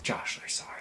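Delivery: breathy whispered aside; whispered apology. Acted. Vlogger whispers sorry to Josh. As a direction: whisper it under your breath.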